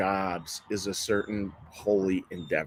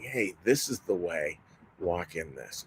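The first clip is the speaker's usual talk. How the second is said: softer, gentle voice from the back of the head; soft inner voice; 4 semitones lower, 7 dB quieter than usual. Retold or imitated. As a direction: ease it down like a quiet inner voice. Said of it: "this little voice in the back of our head, and it's just saying".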